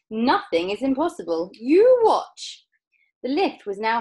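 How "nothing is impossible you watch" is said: loud boast climbing high at the end; Mister Wonka crying out. A narrator's reading. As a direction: build to a triumphant high call. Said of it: "cried Mister Wonka".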